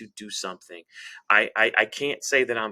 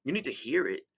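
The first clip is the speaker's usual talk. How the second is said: normal talking voice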